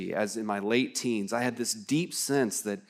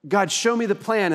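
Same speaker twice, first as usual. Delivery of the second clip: demanding plea; 7 semitones higher, 6 dB louder than usual. Retold or imitated. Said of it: "that's how my mind works".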